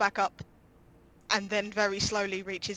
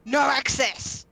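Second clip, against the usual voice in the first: loud, slow, raised guard's command; Warforged guard's challenge; 3 semitones higher, 6 dB louder, much slower than usual. Acted. Warforged guard to approaching party, loud challenge. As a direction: halt them with a booming order. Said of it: "and goes".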